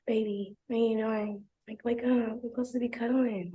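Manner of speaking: soft imitated whine; mock cringey pleading. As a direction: lightly mimic his clingy coaxing. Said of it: "very cringily".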